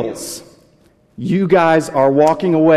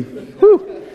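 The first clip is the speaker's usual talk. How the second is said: squeaky high-pitched whew, like a bad smell; high whistling whew. Pastor to the crowd, joking about a stinky neighbor, high whew. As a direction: blow out a sharp high whew.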